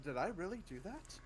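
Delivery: soft, stunned question; quiet disbelief at your own success; bewildered. Acted. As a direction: ask it under-voiced, amazed at yourself. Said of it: "I stare at the apple in bewilderment".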